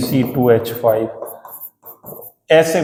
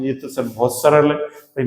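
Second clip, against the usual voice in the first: normal talking voice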